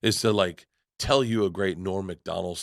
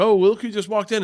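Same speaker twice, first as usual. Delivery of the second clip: mock surprised greeting; 11 semitones higher, 3 dB louder than usual. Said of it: "you'd be like".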